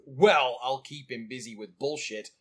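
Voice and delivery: ordinary talk, nothing marked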